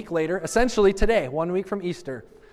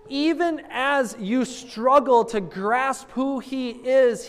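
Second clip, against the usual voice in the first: slow, high, drawn-out sermon build; emphatic preaching; 4 semitones higher, slower than usual. Acted. Preacher reassuring doubters, slow and high. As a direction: lean in and comfort the congregation.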